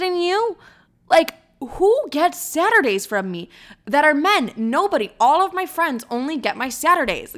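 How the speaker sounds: high-pitched, emphatic indignation; retold rant, hitting key words hard; pissed off. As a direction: act out an outraged rebuke. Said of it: "that pissed me off so much".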